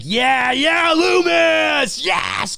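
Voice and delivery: high, stretched cheers; root for the hero like a crowd; acted rapturous audience cheer; cheering fan.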